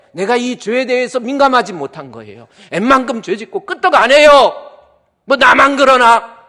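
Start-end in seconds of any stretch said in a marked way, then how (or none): 2.7-6.4 loud, high mock of a careless believer; complacent shrug. Preacher mocking a careless believer, loud shrug. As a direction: shout the shrugging excuse, higher. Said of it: "이렇게 나오는 거예요".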